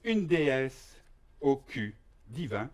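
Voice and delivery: ordinary delivery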